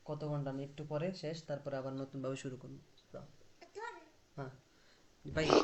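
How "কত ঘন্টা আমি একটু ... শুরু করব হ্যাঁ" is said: much softer, turned away from the mic; off-mic aside. Real, not acted. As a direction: speak aside to someone else in the room. (অন্য কাউকে নিচু স্বরে বলা)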